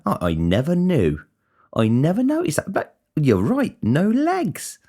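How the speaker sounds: high, slow, exaggerated polite surprise; mock fake politeness. Coworker faking surprise at a man with no legs, hammed-up. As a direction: ham up the polite lie, pitch climbing.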